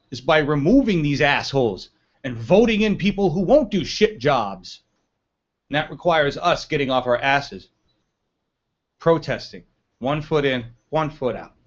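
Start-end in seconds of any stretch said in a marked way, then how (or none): none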